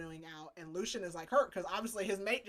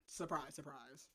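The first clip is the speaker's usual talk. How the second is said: dry aside; 5 semitones lower, 9 dB quieter than usual. Real, not acted. Sarcastic aside about Tamlin's shock.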